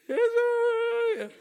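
put-on small boy. Son meekly answering his father, high small voice. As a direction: squeak it out like a chastened child.

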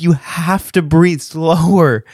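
loud, high, slow re-enacted instruction; insistent coaching. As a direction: spell it out slowly and forcefully. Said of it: "This is how I was handling it".

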